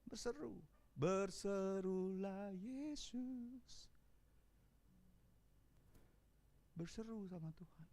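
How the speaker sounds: very soft, prayerful calls; gentle altar-call murmur; hushed invocation. Acted. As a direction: whisper the prayer into the mic.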